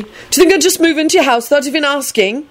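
high, indignant question; indignant high-pitched retort; vehement. A narrator's reading. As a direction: push back hard, pitch up. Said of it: "Neville replied vehemently".

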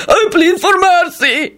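high, drawn-out goblin wail; frantic wail. A narrator's reading. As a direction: wail desperately for mercy. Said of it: "he wailed frantically".

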